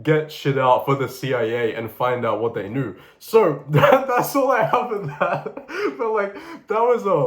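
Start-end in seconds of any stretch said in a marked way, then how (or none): 3.2-7.2 higher, laughing wind-down; giggling as the story wraps up. Real, not acted. Finishing the rabbit-hole story, still laughing.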